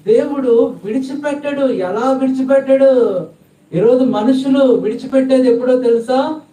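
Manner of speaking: high, loud preacher's cry to the crowd; fiery preaching. Acted. Preacher urging the congregation, in a high, loud cry. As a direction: preach it with rising fervour.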